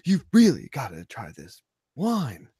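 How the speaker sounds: high, loud, drawn-out mock Shatner voice; William Shatner impression. Mock celebrity voice hosting a wine show. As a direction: ham it up like a dramatic TV star.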